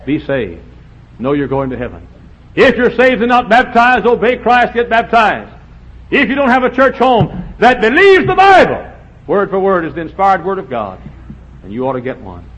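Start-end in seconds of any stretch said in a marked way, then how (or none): 2.6-10.7 loud, high urgent pulpit call; preaching exhortation. Acted. Preacher urging unsaved listeners to get baptized, loud. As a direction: press them hard to obey.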